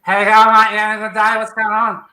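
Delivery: ordinary talk, nothing marked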